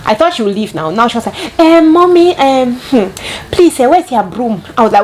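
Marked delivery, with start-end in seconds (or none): 1.6-4.6 high, slow, hesitant plea; hammed-up timid nanny; high mock nanny voice. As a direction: voice the new nanny asking shyly. Nanny's timid request, voiced in story.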